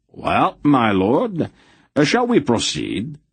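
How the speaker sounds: cheerfully pressing the beaten lord to go on. A narrator's reading. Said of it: "he said cheerfully".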